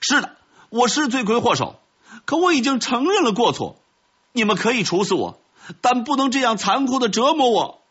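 high-pitched, desperate plea; loud shout. A narrator's reading. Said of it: "大声地喊道". (尖声大喊着申辩)

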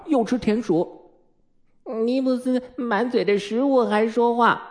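put-on high-pitched child tattletales; high, piping complaints; tattling children. A narrator's reading. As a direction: tell on each other like little kids. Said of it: "讨厌的告发又开始了".

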